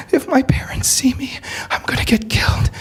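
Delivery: whispered high, scared confession; frightened whisper. Retold or imitated. Hijabi girl whispering her fear of her parents, scared whisper. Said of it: "The hijabi girl".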